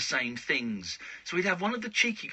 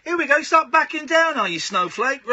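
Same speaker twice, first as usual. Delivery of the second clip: loud teasing; 13 semitones higher, 11 dB louder than usual. Real, not acted. Teasing co-host backing down.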